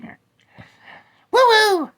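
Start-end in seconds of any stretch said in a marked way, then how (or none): 1.3-1.9 whoop. Acted. Bear whoops about girlfriend.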